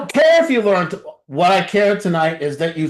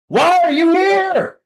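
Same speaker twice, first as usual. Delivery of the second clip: slow, high shouted challenge; preacher shouting at the room; shouted question; 9 semitones higher, slower than usual. Real, not acted. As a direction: hurl the question at them. Preacher confronts the audience.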